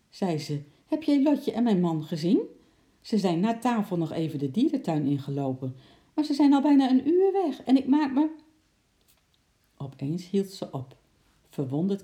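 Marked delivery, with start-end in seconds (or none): 0.8-8.3 rising to high, loud agitation; worried urgency. A narrator's reading. Mother asks Bart after her missing husband and Lotje, increasingly worried. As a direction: build the worry until it breaks off.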